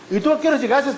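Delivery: high, accusing challenge; accusing question. Retold or imitated. Supervisor blames the volunteer who phoned, accusing question.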